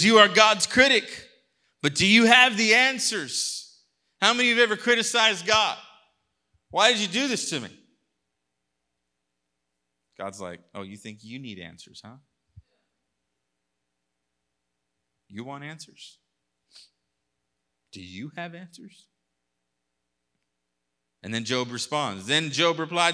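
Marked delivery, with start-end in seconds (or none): 0.0-3.8 high, loud rebuke; preaching shout. Acted. God rebuking Job, shouted high and loud. 4.2-7.7 fast, high, loud appeal; fervent preaching. Acted. Preacher voicing complaints against God, fast and loud. 10.7-19.1 much softer, teasing God; quiet challenge. Acted. God teasing Job, much softer.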